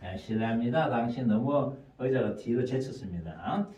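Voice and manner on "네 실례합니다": ordinary talk, nothing marked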